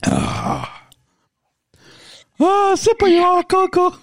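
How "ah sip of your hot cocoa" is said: funny put-on voice. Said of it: "that voice I did".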